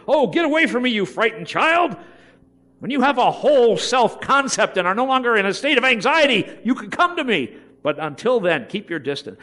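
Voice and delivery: put-on loud, haughty brush-off of a frightened child; mock cold parent. Preacher mocking a parent who pushes away a scared child. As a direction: ham up a cold, pompous parent.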